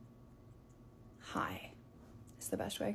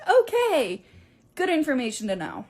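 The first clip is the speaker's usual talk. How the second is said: sarcastic okay; 6 semitones higher, 5 dB louder than usual. Retold or imitated. Friend's sarcastic reply.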